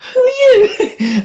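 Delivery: high, slow laughing squeak of disbelief; mock-stunned laughing cry. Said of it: "I was just like".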